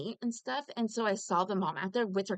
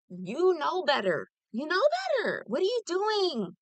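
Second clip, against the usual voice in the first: higher, pressing "You know better" at a friend; scolding; 8 semitones higher, 4 dB louder than usual. Retold or imitated. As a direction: call her out, rising on "You know better". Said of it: "Bose, I say".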